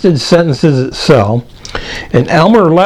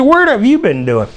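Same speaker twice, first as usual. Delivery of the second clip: higher-pitched imitation of a startled mother; mock astonished mom; 7 semitones higher than usual. Mom to her dirt-covered son, mock astonished. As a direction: question the mess in a retold mom's higher voice.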